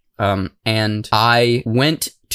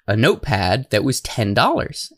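usual speaking voice

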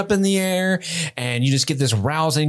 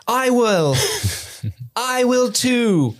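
high, slow heroic vow; mock pledge; 7 semitones higher, much slower than usual. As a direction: stretch it out grandly.

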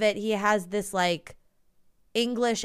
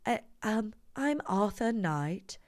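put-on cut-glass English accent; 5 dB quieter than usual. Host impersonating Arthur Knight introducing himself, cut-glass English accent. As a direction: imitate royal-family English at the front of the mouth. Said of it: "it's called a cut glass English accent".

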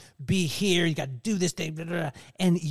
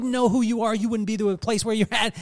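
pep talk; 5 semitones higher, 5 dB louder than usual. Retold or imitated. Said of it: "He said".

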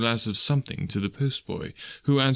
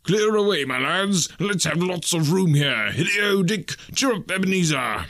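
Fezziwig voice; 6 semitones higher than usual. Acted. Fezziwig rousing the room.

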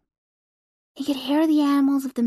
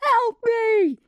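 high panicked scream for help; yelling while running away; 12 semitones higher than usual. Acted. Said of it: "he screamed as he ran".

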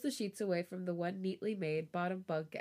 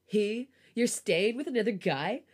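mildly raised, louder accusing question; louder, a touch outraged; 3 semitones higher, 6 dB louder than usual. A narrator's reading. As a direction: lightly act a boyfriend raising his voice. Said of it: "Mark raises his voice".

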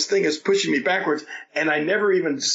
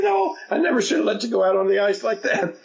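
higher, anxious apologetic voice; fretting helper; 8 semitones higher than usual. Retold or imitated. WWE helper apologizing after the fall, anxious. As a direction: blame yourself, worried. Panicking helper still talking.

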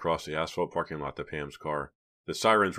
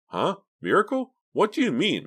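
very high, loud puzzled questions; confused; 6 dB louder than usual. A narrator's reading. Mickey asks Clay what he means, confused. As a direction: squeak it out, baffled.

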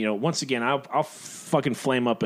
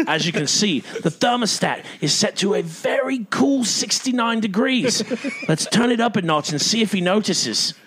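nature documentary; 5 semitones higher than usual. Retold or imitated. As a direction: narrate like a croc hunter spotting prey. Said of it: "He's like".

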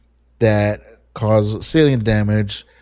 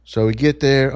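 ordinary talk

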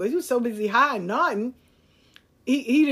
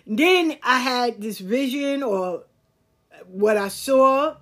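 ordinary talk

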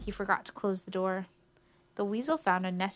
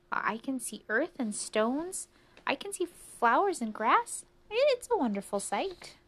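higher, rising wonder line by line; excited mouse; 5 semitones higher than usual. Acted. Mouse tells the stones what it saw, excited. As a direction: build the delight as you list the view.

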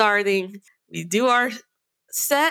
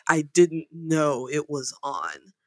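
low, slow mock excuse; imitated dim, apologetic mumbler; meek sound guy; 6 semitones lower, slower than usual. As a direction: mimic a sheepish culprit. Sound guy excusing himself meekly.

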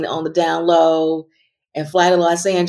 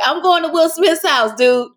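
much higher, gushing boast; high-pitched, giddy mock brag; excited mock blurt; 12 semitones higher than usual. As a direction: blurt the secret to the driver. Imagined blurt to the driver.

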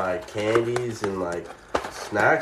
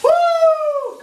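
big high sneeze shouted out; loud whooping sneeze; 8 dB louder than usual. Real, not acted. Sneezing on camera mid-story, loud whooping sneeze. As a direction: let a sneeze burst out with a whoop. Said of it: "that's a good sneeze".

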